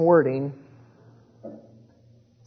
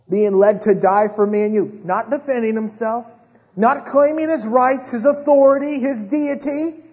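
loud, high pulpit cadence; fervent sermon build; impassioned preaching; 6 semitones higher, 7 dB louder than usual. Real, not acted. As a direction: drive each phrase home, climbing in pitch. Preaches Christ's silent sacrifice.

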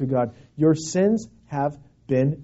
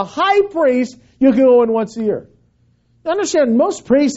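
raised, forceful preacher's voice; emphatic preaching; 6 semitones higher, 4 dB louder than usual. Acted. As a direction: warn them forcefully, pressing each point.

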